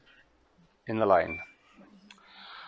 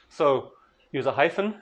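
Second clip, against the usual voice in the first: ordinary delivery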